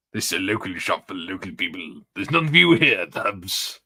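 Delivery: half-hearted go at the hostile village shopkeeper; Little Britain shopkeeper. Retold or imitated. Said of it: "Little Britain".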